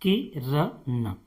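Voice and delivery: के र न said apart, very slowly; spelling out syllables. Shown as a demonstration. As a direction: sound out के, र, न one by one. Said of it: "जैसे किरण". (के र न को अलग-अलग धीरे बोलना)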